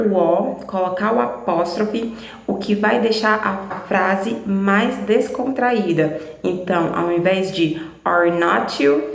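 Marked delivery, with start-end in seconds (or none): none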